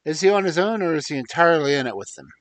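loud, eager and fast. Acted. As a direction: press him eagerly for an answer. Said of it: "said Barker eagerly".

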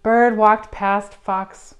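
usual speaking voice

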